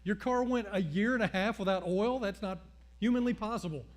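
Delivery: pastor hits back with loud mock outrage; loud disbelief. Pastor hears secretary never changed oil, disbelieving. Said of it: "I was like".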